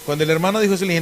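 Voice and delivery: normal talking voice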